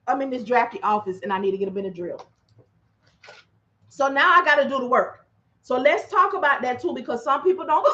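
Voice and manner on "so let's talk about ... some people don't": ordinary delivery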